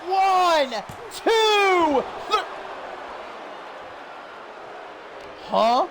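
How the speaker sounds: rising excited play-by-play; wrestling commentator. Acted. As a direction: call the big spot with mounting hype. Live play-by-play climax.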